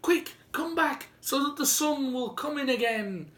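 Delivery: put-on high, loud excited child's call. A narrator's reading. Brave little boy calls the others back, high and loud. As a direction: shout to your friends to hurry. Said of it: "he called to the others".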